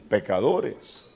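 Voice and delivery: ordinary delivery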